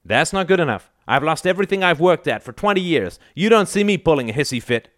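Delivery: high, heated outburst; angry rebuke. A narrator's reading. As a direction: scold him with pitch well up. Father rebukes son in quarrel.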